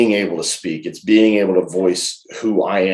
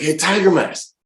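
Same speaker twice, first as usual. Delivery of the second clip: high retold hail; calling out; 9 semitones higher than usual. Wrestler calling a colleague over at the show, high hail.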